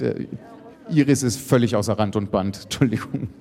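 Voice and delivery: ordinary delivery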